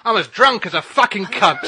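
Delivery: put-on loud, slow, laughing drunken declaration; drunk blurt. [name] answering the DJ on air, loud drunken blurt. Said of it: "And [name] goes".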